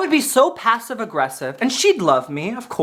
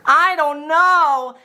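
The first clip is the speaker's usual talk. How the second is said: mock loud, higher, drawn-out protest; loud passenger; 5 semitones higher, much slower than usual. Loud passenger doubting the others, high drawn-out mock protest. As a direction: stretch it out slowly as the loud passenger. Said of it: "she was being so loud".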